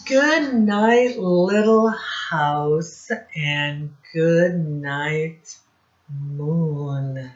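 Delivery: slow, loud storybook reading; reading to children. Acted. Teacher reading Goodnight Moon slowly to the class. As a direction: draw each word out for the class.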